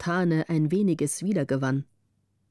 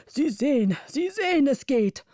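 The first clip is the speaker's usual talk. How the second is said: rising, insistent call, higher on the second sehen; 6 semitones higher than usual. A narrator's reading. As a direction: urge them on, climbing in pitch. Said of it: "in dem er rief". (Ratsherr ruft den anderen zu, höher und eindringlich)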